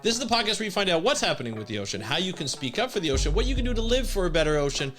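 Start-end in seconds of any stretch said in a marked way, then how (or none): 0.0-3.3 fast, high, bright-energy podcast host opener; upbeat show intro. Acted. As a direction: pump up the listeners as you welcome them in.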